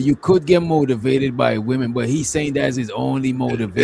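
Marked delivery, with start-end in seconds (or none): none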